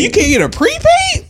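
high, stunned disbelief; can't believe what he's hearing; shocked question; incredulous. Real, not acted. Disbelief at a friend's excuse.

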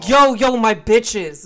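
loud hammed-up street greeting, shouted and high. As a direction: bust in like a mock gangsta hype man.

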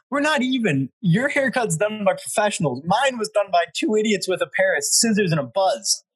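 high-pitched mock indignation; retold freak-out. Buddy shaved bald while asleep, retold freak-out. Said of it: "He woke up in the morning freaking out".

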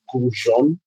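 "Goujon" said as goo-ZHON; French-accented "Goujon"; French pronunciation. Shown as a demonstration. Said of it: "and it's pronounced".

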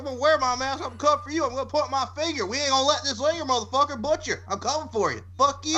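very high-pitched Carolina caricature; mock Carolina accent. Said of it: "You gotta do the accent".

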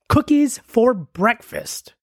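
slow, drawn-out announcer read of the title. Acted. Reading his podcast ad, slow grand announcer voice. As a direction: present the title grandly, slowly.

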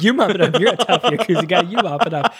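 amused, imitated bossy retort; laughing mock command. Child ordering the gangster around, acted with laughter.

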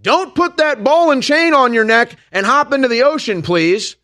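high, loud urgent plea; pleading warning. Retold or imitated. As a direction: warn them off, shouting across the water. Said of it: "So I'm just saying".

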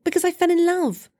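nephew. A narrator's reading. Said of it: "nephew answers uncle".

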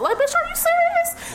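very high-pitched exasperated squeal; incredulous comeback. Retold or imitated. Worker to coworker doubting her speech, squeaky incredulous retort. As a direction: send your voice sky-high in acted disbelief.

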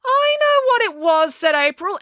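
very high, drawn-out declaration; insistent girl. A narrator's reading. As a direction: announce it with total certainty. April butts in insistently.

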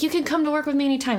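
quick breezy reassurance; fast. Retold or imitated. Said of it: "I'm like".

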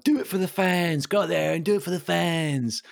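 high-pitched manager rallying the players; mock rousing dressing-room pep talk; half-time team talk.

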